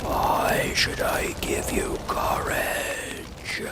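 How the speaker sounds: slow, breathy ghostly whisper; very slow unearthly whispering voice; eerie whispered voice. Acted. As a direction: whisper from inside the fire. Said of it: "demanded Oz".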